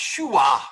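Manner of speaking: shua with the R dropped; wrong shua. Shown as a demonstration. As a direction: say shua, no R at the end. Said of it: "This is not pronounced shua".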